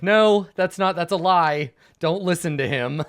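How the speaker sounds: loud, high protest, tipping into laughter; protesting shout. Acted. Game master as enemy leader denying the lie, shouting. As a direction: shout a hammed-up denial to your troops. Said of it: "he's just like".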